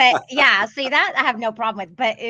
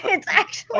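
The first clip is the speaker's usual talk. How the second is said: laughing; 8 semitones higher than usual. Real, not acted. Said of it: "that's actually how I laugh".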